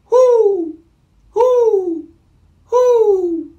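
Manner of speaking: high, loud, held hoots; owl hoot. Shown as a demonstration. Reader leading children in hooting like this owl. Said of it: "Let's try hooting like this owl".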